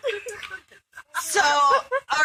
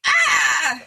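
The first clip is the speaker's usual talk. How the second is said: blind man's yell; 4 semitones higher, 7 dB louder than usual. Retold or imitated. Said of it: "the blind guy's like".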